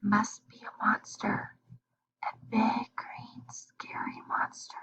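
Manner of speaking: soft, voiceless hush; Cat whispers to her friends. Acted. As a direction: whisper so the monster won't hear. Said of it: "Cat whispered".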